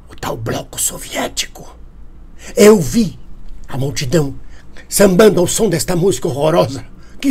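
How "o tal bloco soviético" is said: whisper. Pretend. Alarmed aside.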